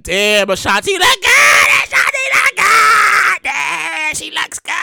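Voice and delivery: excited gushing. Retold or imitated. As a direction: hype it up like a crowd watching. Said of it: "And we like".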